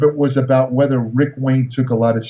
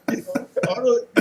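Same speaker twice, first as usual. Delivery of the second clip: ordinary delivery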